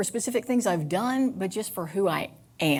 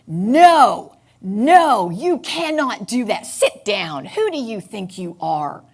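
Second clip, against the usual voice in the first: loud, harsh shouting of an inner guard; inner border guard screaming; 3 semitones higher, 9 dB louder, slower than usual. Retold or imitated. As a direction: bark orders to sit down. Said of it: "the Border Patrol starts screaming at me".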